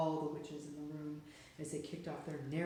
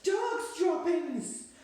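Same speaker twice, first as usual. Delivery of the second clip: very slow, very high, loud screeching yell; witches' yell; 13 semitones higher, 10 dB louder, much slower than usual. A narrator's reading. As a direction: screech it as the witch who sniffs him out. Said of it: "the yell of".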